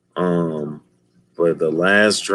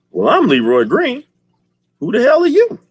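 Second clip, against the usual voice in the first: high, loud defiant challenge; mock angry dad; 5 dB louder than usual. Dad confronting the villain in his pizza shop, mock defiant. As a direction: bark back at the intruder.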